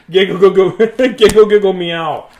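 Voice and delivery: laughing through mock giggles; real laughter with a playful meow; giggling. Real, not acted. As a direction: giggle out the words loud and high. Laughing at the cat.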